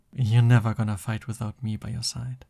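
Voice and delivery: usual speaking voice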